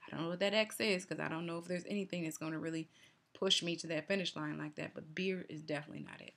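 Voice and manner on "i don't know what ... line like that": usual speaking voice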